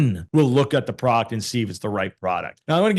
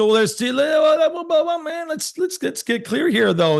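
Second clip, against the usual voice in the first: high-pitched well, well, hold on; high objecting pushback; 12 semitones higher than usual. Real, not acted. Cutting in on the host to stop him, high objecting pushback. Pushing back on host's question.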